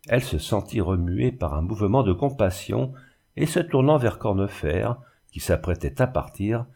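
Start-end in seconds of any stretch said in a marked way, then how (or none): none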